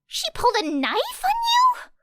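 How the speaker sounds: high, stunned question; shocked squeak. A narrator's reading. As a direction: ask it in shocked disbelief, pitched high. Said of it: "squeaked Uraraka".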